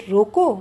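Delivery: रोको hit firmly by itself; stress on रोको. Shown as a demonstration. Teacher demonstrating word stress, रोको stressed alone. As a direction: lean hard on रोको and stop. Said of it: "पर बलाघात करके दिया है".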